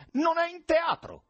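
very high, irritated yell; shout. A narrator's reading. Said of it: "gridò Varenushka". (grido acuto e seccato)

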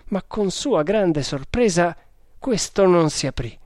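ordinary delivery, nothing marked

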